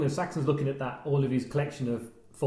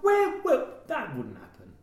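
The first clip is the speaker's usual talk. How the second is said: incredulous; 7 semitones higher, slower than usual. Retold or imitated. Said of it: "And I'm like".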